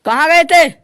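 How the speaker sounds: wife angrily asking the husband where he went; angry demand. Acted. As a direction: snap at the husband where he's been.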